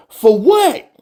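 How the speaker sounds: baffled that anyone would interview the GM; incredulous. Real, not acted. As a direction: ask it like it's absurd. Rising outrage, first of two.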